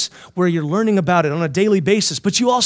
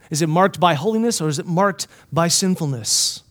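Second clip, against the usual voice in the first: ordinary talk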